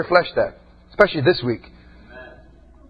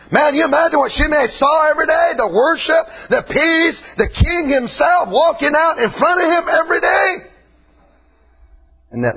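preaching at full force; 9 semitones higher than usual. Acted. Preacher shouting at Shimei that nobody sees the king. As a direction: build it up and shout the king's glory.

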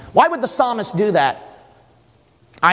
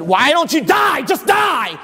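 exasperated devil; 9 semitones higher than usual. Acted. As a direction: throw the command out as the tempter. Devil demands Jesus die.

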